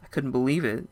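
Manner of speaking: ordinary delivery, nothing marked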